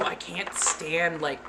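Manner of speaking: ordinary talk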